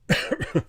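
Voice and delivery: breathy unvoiced cough; cough, choking a little. Real, not acted. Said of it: "I'm choking".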